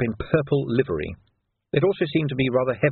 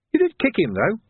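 put-on young Sam voice; 9 semitones higher than usual. A narrator's reading. Sam points out the kick.

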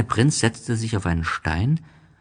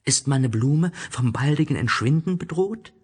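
little prince; 8 semitones higher than usual. Acted. Little prince questions the geographer.

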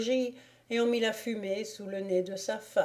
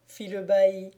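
ordinary delivery

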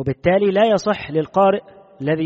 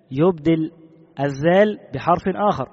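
ordinary delivery

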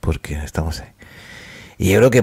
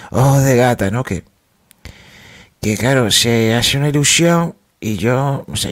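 ordinary talk

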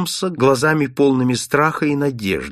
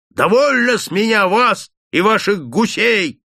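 shouting, higher and louder, fed up; furious yell; 9 semitones higher, 5 dB louder than usual. Acted. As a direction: yell him off the doorstep. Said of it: "орал он". (орёт в ярости, громче и выше)

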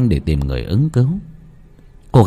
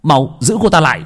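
warning shout; 5 semitones higher, 3 dB louder than usual. Acted. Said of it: "hét lên cảnh báo".

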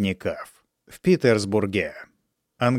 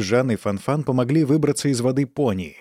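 ordinary delivery

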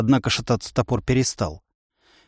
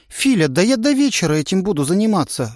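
indignant; 6 semitones higher than usual. A narrator's reading. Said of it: "возмутилась она".

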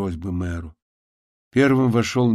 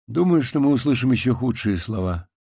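ordinary delivery

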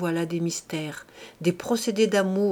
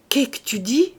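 louder, rising repeat of the question; stunned, rising disbelief; 4 semitones higher, 5 dB louder than usual. A narrator's reading. Husband asks his wife again, stunned and rising. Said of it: "Il bredouillait".

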